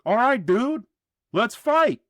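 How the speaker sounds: ordinary delivery, nothing marked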